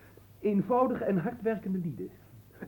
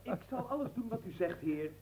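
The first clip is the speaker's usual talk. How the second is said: laughing softly; 4 semitones higher, 8 dB quieter than usual. Acted. Servant answers, laugh heard.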